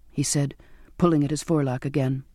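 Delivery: ordinary delivery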